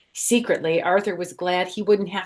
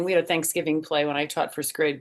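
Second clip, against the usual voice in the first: plain reading voice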